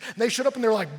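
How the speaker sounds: ordinary talk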